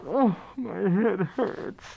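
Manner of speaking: put-on whiny drunk. Drunk friend whining.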